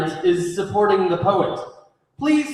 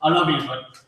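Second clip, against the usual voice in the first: usual speaking voice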